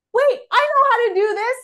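light up with sudden delight; excited discovery. Retold or imitated. Sudden realization while flying.

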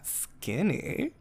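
a hushed, pleased "Skinny?" with a lifted end; soft delighted disbelief. Retold or imitated. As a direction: savour the word "Skinny?" softly, rising. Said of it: "which I know is really deranged".

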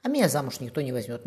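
ordinary delivery

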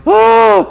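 loud, high mock cry of indignation; imitated outraged "oh". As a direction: imitate an offended teenager's exclamation. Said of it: "And she goes".

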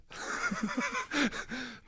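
sudden high-pitched laugh. Real, not acted. Laughs at calling himself legend.

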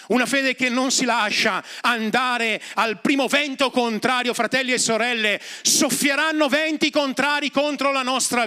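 high, ringing pulpit voice rising to the climax; preaching at full force. Acted. Preacher rousing the congregation about Moses' faith, full-force preaching. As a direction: preach it out, building over the congregation.

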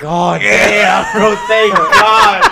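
loud, high, slow relieved outburst; huge relief. Retold or imitated. Hearing the dog died, loud slow relieved cry. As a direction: let the relief burst out, drawn out and loud. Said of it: "so relieved".

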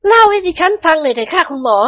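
very high feminine pleading; tearful plea. A narrator's reading. Praphai begs the doctor to tell, choked plea. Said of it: "ประภัยพูดเสียงเครือ".

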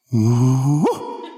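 low, drawn-out mock hyena whoop; imitated hyena call. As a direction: sound like a distant hyena in the night. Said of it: "we hear this".

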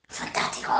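whispered with relish, almost to herself. Real, not acted. Telling a friend about the book, whispering her delight. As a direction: breathe the word out.

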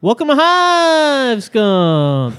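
slow, high, drawn-out host intro; slow announcer voice. Acted. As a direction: stretch it out like a showman. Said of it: "That was so bad".

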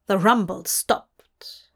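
plain reading voice

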